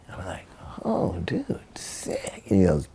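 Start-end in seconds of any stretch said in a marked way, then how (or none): 0.8-2.4 breathy, whispered stoked reaction; barely voiced, impressed murmur; hushed awe. Skater hears about a ledge into a bank, hushed awe.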